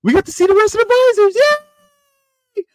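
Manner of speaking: high, loud and fast excitement; excited. Real, not acted. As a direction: burst out thrilled and quick. Excited opening about the visors.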